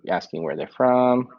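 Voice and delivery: ordinary talk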